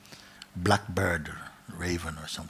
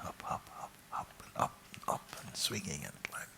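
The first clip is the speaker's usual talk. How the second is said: hushed whisper; 12 dB quieter than usual. A narrator's reading. Storyteller whispering the climb to listeners, hushed. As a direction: draw them in with a whisper.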